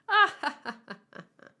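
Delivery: loud laugh. Acted. Character laughs aloud.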